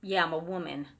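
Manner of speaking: a lighter imitation of the gruff man; mock gruff answer. Retelling how the man answered, lightly voiced gruff. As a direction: toss it off as the grumpy spirit, lightly. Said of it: "he was like".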